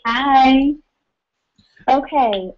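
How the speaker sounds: normal talking voice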